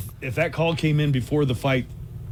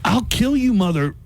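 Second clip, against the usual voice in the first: mock threat; 9 semitones higher than usual. Retelling the rival's threat.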